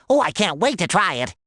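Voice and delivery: ordinary talk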